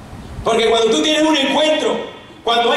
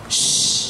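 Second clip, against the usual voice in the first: long hushing "shh"; shushing; 4 dB quieter than usual. Acted. People telling the crying woman to hush, shushing. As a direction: hiss a long shush for silence.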